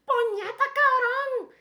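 loud, drawn-out Puerto Rican curse, high; Puerto Rican accent. Retold or imitated. Mimicking her Puerto Rican friend's new slang, loud Puerto Rican accent. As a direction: belt it like a fresh-off-the-plane Puerto Rican. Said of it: "acabas de aterrizar de San Juan".

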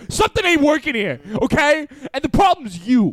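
high-pitched mock-menacing confrontation; Goodfellas tough guy. Imitating friend's Goodfellas moment, mock mobster confrontation. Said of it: "That was such a Goodfellas moment".

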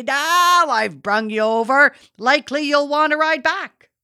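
a hammed-up father teasing his son at the saw. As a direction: mimic Dad ribbing his boys. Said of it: "Then he'd laugh and say".

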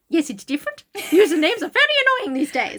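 ordinary talk, nothing marked

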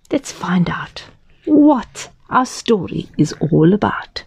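put-on hushed whisper to the children. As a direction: draw them in with a secret whisper. Hushed invitation to listeners.

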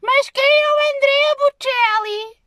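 a dopey, squealing question; mocking clueless person. Mocking people who ask who [name] is, squealing high and loud. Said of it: "vêm algumas pessoas assim".

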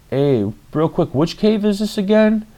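a smug bro mocking the girls with a high, fake-sweet question; mocking put-down. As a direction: play dumb to belittle the guide. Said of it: "trying to belittle these fucking girls".

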